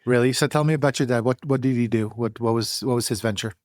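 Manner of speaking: ordinary talk, nothing marked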